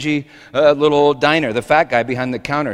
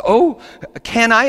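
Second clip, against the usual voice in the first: weepy; 9 semitones higher, 4 dB louder than usual. Acted. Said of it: "she broke down weepy".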